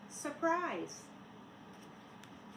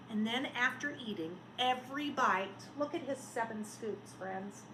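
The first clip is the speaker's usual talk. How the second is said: plain reading voice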